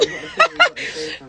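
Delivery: high airy laugh at herself; high breathy laugh. Real, not acted. Embarrassed by crying on stream, laughing it off.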